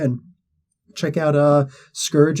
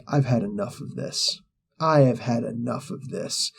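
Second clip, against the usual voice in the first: low quiet fury; 2 semitones lower, 7 dB quieter than usual. Retold or imitated. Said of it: "Thor's like".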